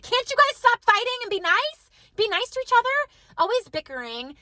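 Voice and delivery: high-pitched retold mom pleading; mock-beg squabbling kids to stop; exasperated plea.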